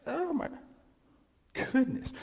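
high, delighted amazement; amazed oh my. Retold or imitated. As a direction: gasp at the wonder of it. Marvelling at the verse.

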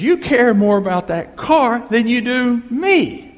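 high, loud, slow accusation; hammed-up furious wife, stretching the words; really mad wife. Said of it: "now she's really mad".

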